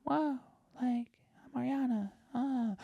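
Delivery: very high, whispery pop-star impression; mock a tiny, breathy high voice; slow, hushed and sky-high, mocking. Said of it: "she had to go back to her".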